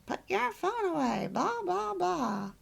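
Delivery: retold scolding. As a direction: imitate your own annoyed outburst, pitch jumping up. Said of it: "I got really mad at him".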